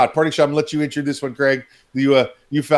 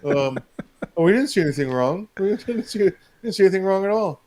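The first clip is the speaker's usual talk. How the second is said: higher mock-innocent voice, lightly done; mock leftist; 5 semitones higher than usual. Co-host mimicking critics who pretend they saw nothing. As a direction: play dumb, feigning innocence. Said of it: "They're like".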